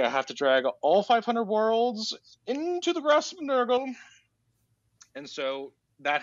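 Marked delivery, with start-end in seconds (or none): 0.0-3.9 grandiose villain vow. Retold or imitated. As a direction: build into an overblown high declaration.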